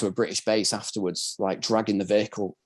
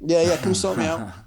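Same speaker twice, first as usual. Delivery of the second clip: higher-pitched, chummy plea for help; mock pleading; 7 semitones higher than usual. Wounded soldier calling his medic pal over, mock pleading. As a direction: play up an eager, jokey appeal.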